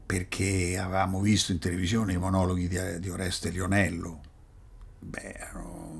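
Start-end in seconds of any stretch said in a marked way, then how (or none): 5.0-5.9 soft breathy awe; whispered. Real, not acted. Awed about Lionello.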